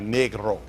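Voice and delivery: "negro" with a short e, as in neck; original non-English pronunciation. Shown as a demonstration. Teacher showing the word before English changed it, short-e negro. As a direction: say negro as NEG-ro, short first vowel. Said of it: "It's not negro".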